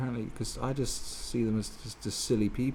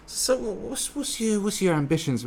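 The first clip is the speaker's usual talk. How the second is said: high, chirpy put-on asker's voice; casual question; 13 semitones higher, 5 dB louder than usual. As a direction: ask it in a high breezy voice. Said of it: "and said".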